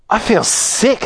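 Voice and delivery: high, small complaining voice; queasy girl. Acted. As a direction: complain weakly, pitched high. Violet feeling ill as she swells.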